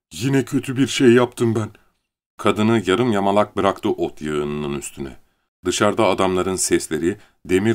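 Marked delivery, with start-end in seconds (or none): 0.1-1.7 higher, voiced, anxious confession; fearful realisation. A narrator's reading. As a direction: admit it with rising dread. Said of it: "Sonra korkuyla fısıldadı". (korkuyla, kaygılı)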